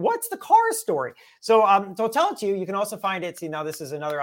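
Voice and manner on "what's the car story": high, louder mock-curious question; curious onlooker. Speaker voices an audience member asking about the story, high-pitched curiosity. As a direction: pipe up as an imagined wondering listener. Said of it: "maybe somebody was wondering".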